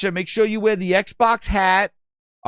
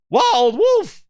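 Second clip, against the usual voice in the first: much higher, louder call of the name; 12 semitones higher, 4 dB louder than usual. Real, not acted. Calling out chatter's name.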